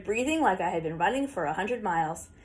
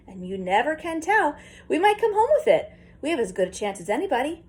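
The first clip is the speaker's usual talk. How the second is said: eager; 7 semitones higher than usual. A narrator's reading. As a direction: tempt him with the gold cup. Grandpa eagerly pitching the contest.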